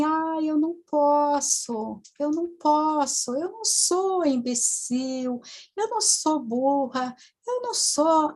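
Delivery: put-on regressed little-girl voice; childlike voice. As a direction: whine slowly like a little girl protesting. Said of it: "uma voz bem infantil".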